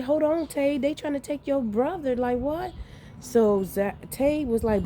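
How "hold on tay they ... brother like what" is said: high-pitched, urgent mother voice; retold alarm. Mother telling her son his brother is being taken, alarmed. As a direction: re-enact alarm, pitched well above usual talk.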